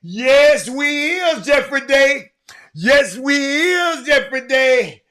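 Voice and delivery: loud, high, slow playful proclamation; drawn-out holler. Pretend. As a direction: stretch it out like a rousing call. Drawn-out mock-preacher sign-off to listeners.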